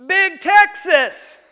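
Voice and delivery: high, loud, slow drawn-out hallway yell; yelling a nickname. Retold or imitated. Old man hollering at pastor down the church hallway. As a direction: call it out across the hall. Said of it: "he yells down the church hallway".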